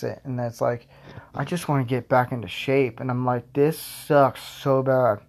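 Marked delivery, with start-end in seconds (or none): 3.5-5.2 slow, drawn-out moan; drawn-out complaint. Retold or imitated. To himself about missing the gym, a slow drawn-out moan. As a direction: stretch out a sulky complaint. Own frustrated thought.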